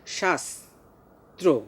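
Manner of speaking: শাস্ত্র very slow as shas, pause, tro; syllable-by-syllable demo. Shown as a demonstration. Teacher demonstrates the dental s, very slow articulation. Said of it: "দন্ত স্বয়ের মতো উচ্চারণ করছি".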